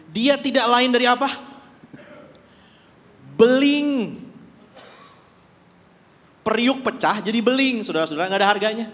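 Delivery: loud, high, emphatic preaching; fiery preaching. Acted. Preacher calling sinners worthless shards, shouting. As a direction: hammer the point home.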